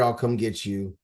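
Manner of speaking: ordinary talk, nothing marked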